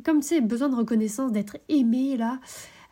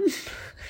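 short breathy nervous laugh; a quick unvoiced nervous chuckle; 5 dB quieter than usual. Real, not acted. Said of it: "mais c'est un rire nerveux".

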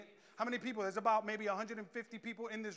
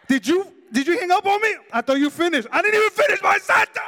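indignant lover; 8 semitones higher, 18 dB louder than usual. Retold or imitated. Partner caught hanging up.

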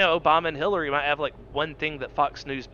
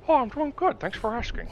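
much higher silly friend voice; put-on Wesley voice; 10 semitones higher than usual. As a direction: chirp it back in a high voice. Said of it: "Hey, Wesley, how are you today?".

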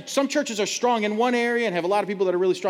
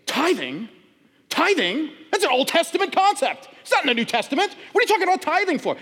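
high, loud incredulous challenge; mock outraged correction, pitched way up; indignant retort; 10 semitones higher, 3 dB louder than usual. Pastor mocking the older man's outraged reply to his joke, incredulous. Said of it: "He looks at me and he goes".

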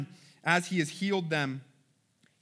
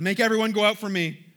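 raised, louder command, no sobbing; crying out; 4 semitones higher, 6 dB louder than usual. A narrator's reading. Joseph clearing the room before his brothers, crying out. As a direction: cry out the order, higher and louder. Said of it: "He cries".

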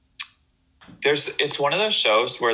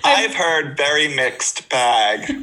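teasing mimicry; 3 semitones higher, slower than usual. Co-host mimicking her friend's botched sentence, teasing mimicry. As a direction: draw it out to tease.

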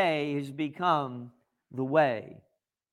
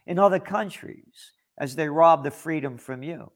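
usual speaking voice

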